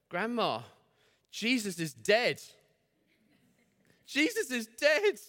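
put-on high, slow little-girl voice, sad. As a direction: play a small girl telling her grandma the sad news.

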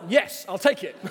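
quick delighted acceptance, voice up; eager yes. Retold or imitated. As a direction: jump on it, loud and delighted. Eager reply to calves question.

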